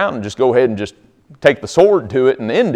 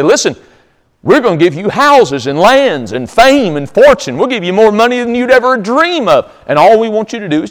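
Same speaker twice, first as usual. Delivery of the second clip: higher, animated acted offer; enticing sales pitch; 8 semitones higher, 3 dB louder than usual. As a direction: dangle the riches eagerly, pitch climbing. Servants tempt Balaam with riches.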